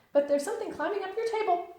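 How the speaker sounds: very high, loud bouncy hello; squeaky bright Tigger greeting. Acted. Tigger answering Pooh's hello outside the door.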